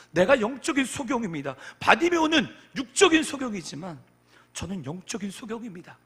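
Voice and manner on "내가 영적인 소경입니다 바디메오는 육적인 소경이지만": loud, high declaration; fervent, raised sermon voice; forceful preaching. Real, not acted. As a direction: confess it at full preaching force.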